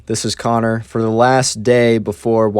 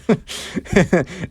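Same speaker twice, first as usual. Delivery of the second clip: mock laugh; 7 semitones higher than usual. Friend laughing at him.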